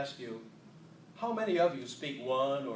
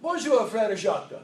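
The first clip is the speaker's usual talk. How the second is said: higher, louder hammed-up French greeting; mock French accent; 7 semitones higher, 8 dB louder than usual. Shown as a demonstration. Speaker reciting his French vocabulary in a mock accent. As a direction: greet grandly like a fake Frenchman. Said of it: "my entire French vocabulary".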